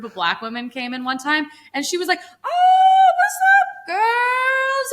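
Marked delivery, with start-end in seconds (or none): 2.4-4.9 very high, loud, drawn-out mock greeting; put-on code-switched greeting. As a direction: exaggerate a gushing, squealed hello, stretching the words. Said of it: "was, like, doing this".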